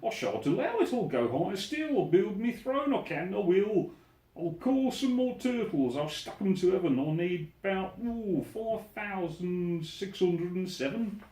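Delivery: a vain king scheming out loud; ranting turtle king. Acted. Yertle ranting at the moon.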